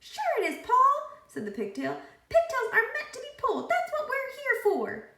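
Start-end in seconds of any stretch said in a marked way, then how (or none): 0.0-1.1 high, squeaky talking pigtail. A narrator's reading. 2.3-5.1 high, squeaky talking pigtail. A narrator's reading. Pigtail insists.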